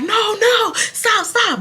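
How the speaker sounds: high-pitched imitation of a dad intervening; retold urgent protest. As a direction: act out a parent calling a halt. Retelling dad usually intervening.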